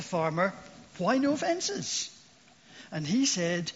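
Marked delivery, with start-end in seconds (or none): 1.0-2.1 much higher and slow, baffled question; mock bewildered tourist; puzzled tourist. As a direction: ask it like a visitor who can't believe it. Surprised tourist asks farmer.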